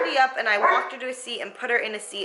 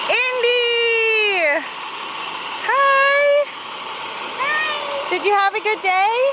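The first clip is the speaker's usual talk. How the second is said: excited greeting; 14 semitones higher, 6 dB louder, much slower than usual. Real, not acted. Mother greeting her daughter at the bus stop, excited and loud. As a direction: call out to her with joy.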